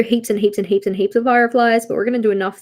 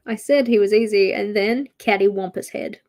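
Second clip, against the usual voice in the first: ordinary talk, nothing marked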